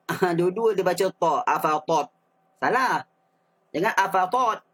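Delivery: ordinary delivery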